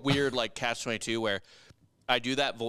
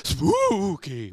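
higher, louder spooky creature; new ghoul voice; 7 semitones higher, 5 dB louder than usual. Acted. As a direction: hiss it like a ghoul. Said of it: "go with the new voice".

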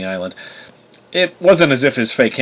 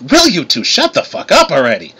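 exasperated interruption; 5 semitones higher than usual. A narrator's reading. Narrator cuts off bickering brothers.

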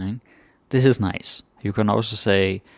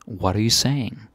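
ordinary talk

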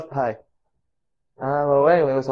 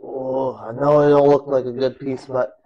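ordinary delivery

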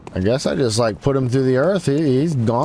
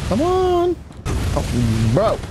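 high, stretched-out complaint; exasperated come on, much higher; frustrated whine; 9 semitones higher, much slower than usual. Real, not acted. Frustrated at the game.

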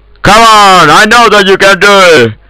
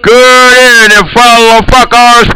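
calling out; 6 semitones higher than usual. Real, not acted. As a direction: call the greeting out high and long. Repeats greeting, calling out heatedly. (heated, high call to everyone)

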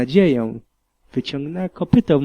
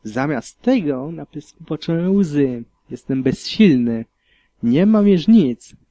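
usual speaking voice

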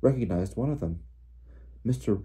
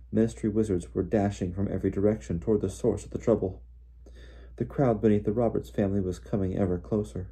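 plain reading voice

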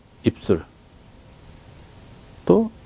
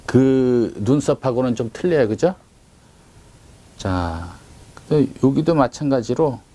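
ordinary talk

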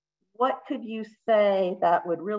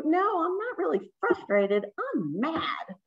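higher, insistent correction; mock correcting reply; 8 semitones higher than usual. Shown as a demonstration. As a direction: correct them, a little heated. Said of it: "Or I could go".

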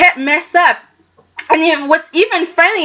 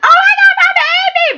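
excited shriek; faster than usual. Retold or imitated. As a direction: squeal it like a thrilled grandma. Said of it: "And Ashley was like".

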